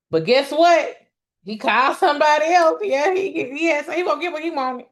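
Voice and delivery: exasperated gossip reveal; rising outrage at him; indignant outburst. Real, not acted. Outraged aside about [name].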